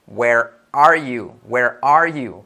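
Where are you? drawn out at half speed; slow demo; slow model line. Shown as a demonstration. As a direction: say it slowly to teach. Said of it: "¿Dónde estás?".

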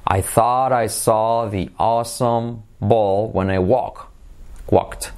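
slow, drawn-out vowels; slow model phrase. Shown as a demonstration. Teacher modelling the awesome-ball phrase for learners, slowly. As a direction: stretch each word out for a learner.

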